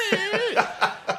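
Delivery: put-on high-pitched childish wail; hammed-up whiny sob; mock crying bleat. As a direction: bawl wordlessly like a little kid. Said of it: "I was a crier".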